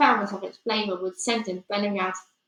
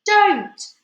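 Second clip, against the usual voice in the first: high, loud cry of alarm; sudden piercing shout; scream; 11 semitones higher, 11 dB louder than usual. Acted. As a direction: scream a desperate warning. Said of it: "Sophie wanted to scream".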